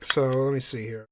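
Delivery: usual speaking voice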